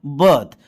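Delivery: बर्थ with the r melted away; correct "birth". Shown as a demonstration. Teacher correcting the wrong readings, short "uh" in बर्थ. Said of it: "इसको हम अ बोलेंगे".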